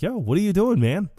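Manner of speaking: high-pitched challenge to a stranger; mock confrontation. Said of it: "he tries to confront him".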